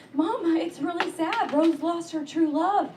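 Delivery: put-on a touch of a tearful kid, slowed down; mild crying child. A kid telling mom about Titanic, mildly tearful. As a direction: whimper it lightly like a sad kid. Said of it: "I started crying and I was like".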